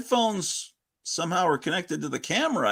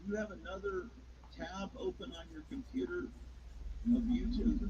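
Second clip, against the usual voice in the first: faint delayed echo through a speaker; distant repeat from another device; echo from second device; 7 semitones higher, 16 dB quieter than usual. Real, not acted. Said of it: "I'm still hearing an echo".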